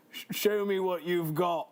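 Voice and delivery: put-on Cockney British accent; Australian accent slipping into Cockney; attempted classy Australian turned Cockney. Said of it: "fully transitioned to Cockney British".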